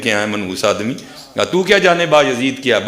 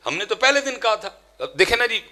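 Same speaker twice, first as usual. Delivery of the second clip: ordinary delivery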